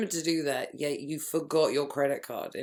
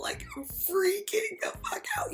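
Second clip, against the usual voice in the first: high, whispery, hammed-up crying that climbs higher; mock hysterical sobbing; 11 semitones higher than usual. Heather on the bad-news phone call, mock hysterical sobbing. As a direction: sob it out like an over-the-top soap heroine. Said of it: "Heather proceeds to be like".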